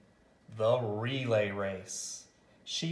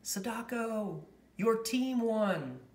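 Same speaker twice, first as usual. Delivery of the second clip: high, excited call; higher excited shout of victory; cried out; 9 semitones higher than usual. Acted. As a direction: call out the win from afar. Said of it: "someone cried".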